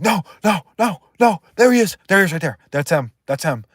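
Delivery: mock panic, spotting him in the crowd; hammed-up scared victim; imitating a panicked man. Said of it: "He'd be like".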